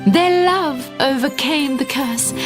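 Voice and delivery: ordinary delivery, nothing marked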